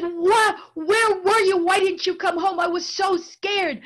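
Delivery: high, loud demanding mother's cry, easing at the end; frightened angry mother. Acted. Mother to daughter come home late, high frightened scolding. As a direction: scold her child out of sheer relief. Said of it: "She was crying. She was angry".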